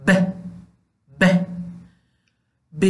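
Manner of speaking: ب said as a bare short b sound; teacher demonstrating how ب sounds; letter sound. Shown as a demonstration. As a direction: make the sound of ب alone. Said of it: "چنین آواز داره".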